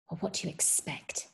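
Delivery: hushed, breathless bitter whisper; choked whisper. A narrator's reading. Mother to her boyfriend, choked whisper. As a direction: whisper it, holding back tears. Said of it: "There was a choke in her voice now".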